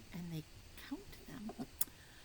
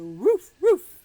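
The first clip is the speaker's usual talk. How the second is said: much higher and louder barks; dog barking; 7 semitones higher, 17 dB louder than usual. Pretend. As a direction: bark like an excited little dog. Barks inside the song.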